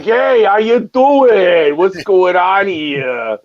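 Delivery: slow, stretched Brooklyn Italian; hammed-up Brooklyn Italian wiseguy greeting. Joking with the streamers, a hammed-up Brooklyn Italian hello. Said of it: "get our Brooklyn Italian on".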